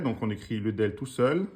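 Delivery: ordinary talk, nothing marked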